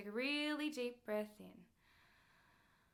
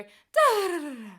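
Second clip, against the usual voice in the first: air dumped at start; 6 semitones higher, 14 dB louder than usual. Shown as a demonstration. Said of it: "You've let it all out".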